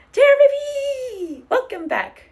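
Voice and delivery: long, high, loud welcoming shout; stretched joyful yell of welcome; excited call. Real, not acted. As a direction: belt the name out in delight. Greeting a returning chat viewer.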